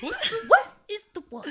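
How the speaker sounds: high, fast mock English accent; hammed-up English accent, rushed; Tyrion impression. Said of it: "best Peter Dinklage as Tyrion".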